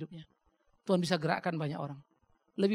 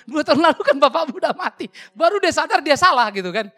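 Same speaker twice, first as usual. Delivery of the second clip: loud laughing telling, higher up; laughing loudly; 9 semitones higher, 15 dB louder than usual. Real, not acted. Pastor retelling the con man's slip to the audience, laughing loudly. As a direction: laugh your way through the punchline.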